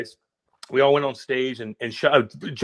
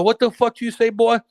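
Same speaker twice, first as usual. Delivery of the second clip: high, louder retort, acted out; mock-indignant comeback, pitched up; retold angry challenge; 8 semitones higher, 5 dB louder than usual. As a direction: re-enact challenging a heckler. Repeats the retold confrontation.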